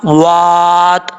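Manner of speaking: वात held long, stopping on a silent ता; recited stop at the verse end; pause form. Shown as a demonstration. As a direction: draw out वात and stop cleanly on the त. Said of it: "जब वक्फ करेंगे तो ता साकिन हो जाएगा".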